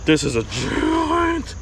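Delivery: very high, slow, stretched-out amazement; awestruck exclaim. Real, not acted. Angler holding up the giant smallmouth, squealing in awe. Landing the huge fish.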